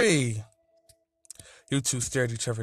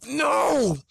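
put-on high, loud childish refusal; whine-yell it like a stubborn child; yelling like a little kid; 13 semitones higher, 5 dB louder than usual. A narrator's reading. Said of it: "he yelled, sounding like a little kid".

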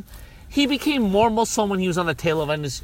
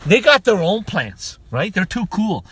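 ordinary talk, nothing marked

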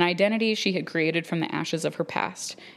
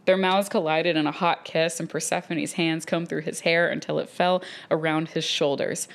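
ordinary delivery

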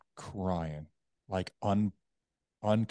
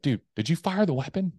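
high, loud imitated friend's question; urgent questioning; 9 semitones higher, 6 dB louder than usual. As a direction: press him urgently, as a worried buddy. Buddy urgently questioning him on phone.

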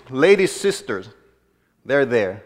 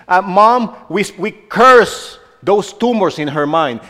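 louder, driving preacher's declaration; preaching; 4 semitones higher, 5 dB louder than usual. Acted. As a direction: proclaim it over the congregation. Preaching declarations over sick.

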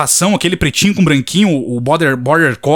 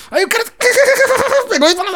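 breaks into high laughter; laughing; faster than usual. Real, not acted. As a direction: laugh through the words. Laughing at his own joke.

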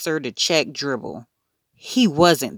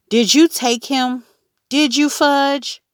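not so calmly; 10 semitones higher, 5 dB louder than usual. A narrator's reading. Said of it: "not so calmly".

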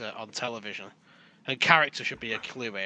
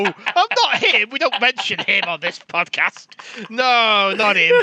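laughing protest; 5 semitones higher, 6 dB louder than usual. Real, not acted. Laughing denial.

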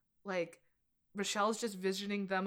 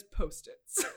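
imitating Romy; 4 semitones lower than usual. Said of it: "I love how Romy says".

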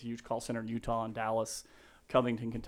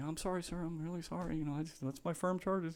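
higher, soft, sheepish sorry; a hint of a nervous junior pleading; meek apology; 5 semitones higher, 8 dB quieter than usual. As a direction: shrink back and apologise to the big man. Meek apology to screaming client.

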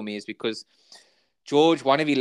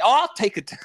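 higher, eager mock acceptance; eager interruption; 10 semitones higher, 3 dB louder than usual. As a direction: jump in as the overeager character.

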